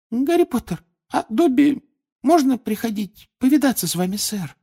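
high, tentative elf voice; meek squeaky request; timid Dobby. A narrator's reading. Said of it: "робко спросил свободный эльф".

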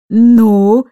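a loud, booming "Ну?". Acted. Aunt booms at the children at the gate. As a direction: boom it out like a battle-axe. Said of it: "прогудела она".

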